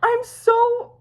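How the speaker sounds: high, drawn-out burst of anticipation; eager suspense. Real, not acted. Fan impatient to find out what happens next episode, high eager suspense. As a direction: stretch it out high, dying to know.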